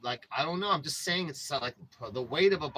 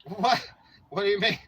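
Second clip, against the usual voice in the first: loud, higher startled what; surprised; 6 semitones higher, 7 dB louder than usual. Real, not acted. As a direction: caught off guard by chat. Reacting to chat comment.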